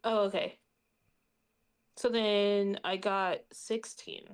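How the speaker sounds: normal talking voice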